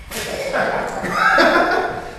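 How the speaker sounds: breathless high-pitched laugh, almost silent; a wheezing giggle at his own story; high airy laugh. Real, not acted. Laughs at the green window trick.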